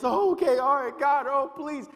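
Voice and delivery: high, loud, frantic pleading; groveling believer. Retold or imitated. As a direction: beg God in a panic. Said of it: "And then you're just".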